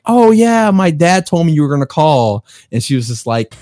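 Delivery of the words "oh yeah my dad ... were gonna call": cheerful cousin. Retold or imitated. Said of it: "she was like".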